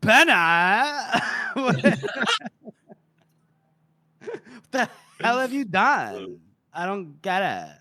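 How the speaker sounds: put-on laughing Valley girl whine; Monza. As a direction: whine it through giggles. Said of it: "Monza's now like".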